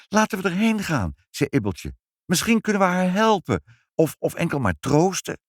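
put-on eager girl. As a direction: urge them to go help. Ibbeltje voiced.

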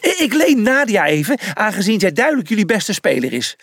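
high-pitched eager chatter; cheerful again. A narrator's reading. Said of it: "Hij was op slag weer vrolijk".

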